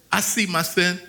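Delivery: ordinary delivery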